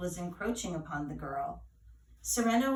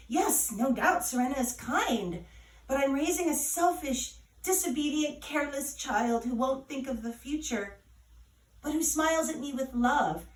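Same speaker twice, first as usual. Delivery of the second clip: higher, louder frustrated mother venting; mother venting to godmother, exasperated and louder; exasperated rant; 5 semitones higher, 5 dB louder than usual. A narrator's reading. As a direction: vent every complaint about the girl. Nana rants about daughter's faults.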